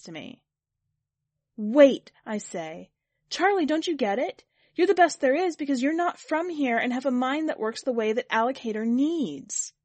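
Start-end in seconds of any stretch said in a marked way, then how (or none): 1.5-2.1 loud, forceful WAIT; urgent interjection. A narrator's reading. Kit halting Charlie to explain, urgent and loud. 3.3-9.7 raised, loud, insistent voice; urgent plea. A narrator's reading. Kit begging Charlie to get it, urgent.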